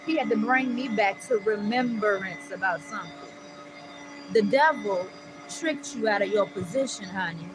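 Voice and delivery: preacher calling out the devil; preaching. Real, not acted. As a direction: testify with full fervor. Preaching to listeners.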